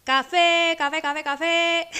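loud high hawker's cry; street vendor shout. Retold or imitated. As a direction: belt it out to sell coffee. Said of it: "empieza a gritar".

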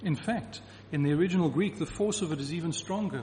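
usual speaking voice

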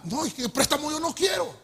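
angry no to the loan; angry refusal. Retold or imitated. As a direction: shout it down. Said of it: "me puse bravo".

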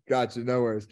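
normal talking voice

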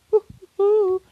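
very high, loud playful hoot; high whoop. Real, not acted. Podcaster asking listeners for dating advice, whooping high. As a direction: let out a loud, high-pitched "woo".

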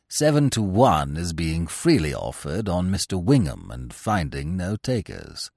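low, measured manservant's report; Jeeves the valet. Acted. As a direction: report it like an unflappable butler. Jeeves replies to Bertie.

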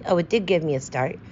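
ordinary delivery

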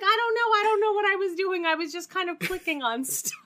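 put-on high, fast, flustered old lady; flustered grandma. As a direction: play a confused grandma, rushed. Grandma's flustered reply imitated.

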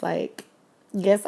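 normal talking voice

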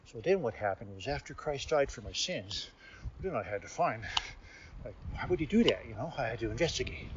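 soft, hushed detective-like beast voice; Cecil's changed voice. Retold or imitated. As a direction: murmur it like a stuffed animal on a case. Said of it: "he would start talking like this".

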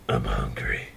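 very low, quiet mumble; stoned deadpan. Retold or imitated. Stoned buddy announcing he is hungry, low quiet mumble. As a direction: drop right down low and mumble it. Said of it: "and he goes".

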